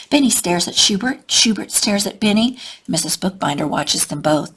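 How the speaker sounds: ordinary delivery, nothing marked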